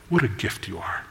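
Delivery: soft, low, hushed adoration; quiet tender awe; tender praise. Acted. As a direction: breathe it to your bride like a gift. Groom tenderly praising his bride.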